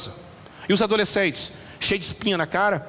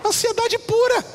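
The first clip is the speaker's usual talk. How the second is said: mock dramatic; slower than usual. Mocking punchline after child's complaint.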